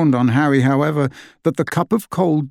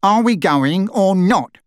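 higher, louder, slow impatient roar; 6 semitones higher, 4 dB louder than usual. A narrator's reading. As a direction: bellow from the doorway. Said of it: "roared Uncle Vernon".

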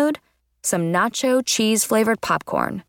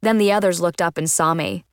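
usual speaking voice

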